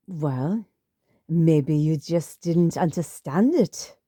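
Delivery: put-on slow, pointed German-accented jab; mother-in-law needling in a German accent, slowly; a hint of a slow German accent. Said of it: "thick German accent".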